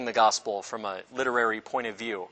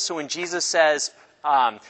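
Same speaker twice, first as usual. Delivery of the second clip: usual speaking voice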